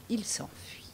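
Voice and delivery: hushed storyteller whisper; whispered narration. A narrator's reading. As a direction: breathe the line like a secret.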